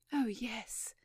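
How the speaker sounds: eager, breathy pleading; eager whisper. Acted. Young lady eager for police, whispered. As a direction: rush it out eagerly. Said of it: "the young lady said eagerly".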